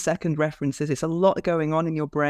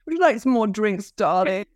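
put-on higher-pitched posh English accent; very posh madame; 4 semitones higher than usual. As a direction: play a posh club madame. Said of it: "adapt my voice and go very posh".

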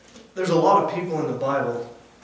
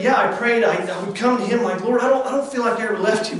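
higher and louder, pressing the confession; rising preaching; 6 semitones higher, 6 dB louder than usual. Acted. Preacher to his congregation, rising preaching. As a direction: preach it with mounting force.

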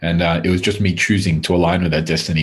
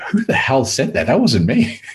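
shocked disbelief; 7 semitones higher than usual. Retold or imitated. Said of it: "And I remember going".

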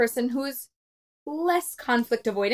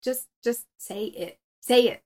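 imitated impatient husband; 5 dB louder than usual. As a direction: mimic a husband cutting the story short. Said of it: "but he tells me".

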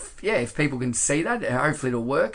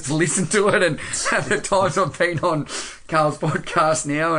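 laughing; 4 semitones higher, 4 dB louder than usual. Real, not acted. Apologising for his voice, laughing.

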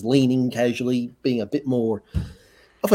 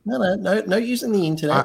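playful scolding; 9 semitones higher than usual. Real, not acted. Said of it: "teasing cohost".

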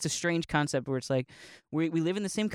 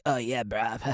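a light surfer drawl with a laugh in it; surfer dude; 5 semitones lower than usual. Retold or imitated. Mocking how surfers talk, lightly voiced surfer dude. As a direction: toss it off like a laid-back surfer.

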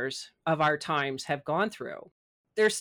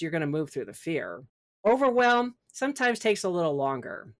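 normal talking voice

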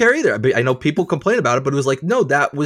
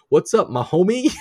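mock homie voice; 7 semitones higher than usual.

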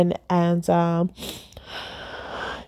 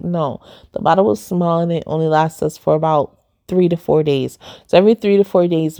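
ordinary talk

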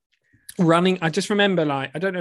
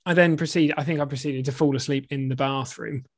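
normal talking voice

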